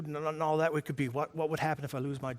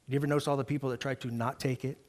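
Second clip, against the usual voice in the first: usual speaking voice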